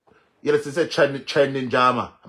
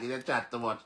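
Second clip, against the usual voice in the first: normal talking voice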